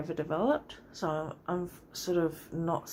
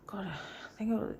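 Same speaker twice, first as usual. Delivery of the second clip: whisper; 3 semitones higher, 6 dB quieter than usual. Real, not acted. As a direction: whisper while searching memory. Muttering to herself mid-story.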